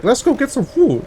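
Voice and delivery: retold in a light, high voice; high-pitched quote. Retelling the couple's food plan, high-pitched mimicry. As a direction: jump high for the eager suggestion.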